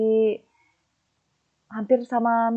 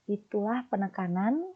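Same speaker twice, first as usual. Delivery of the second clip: ordinary talk, nothing marked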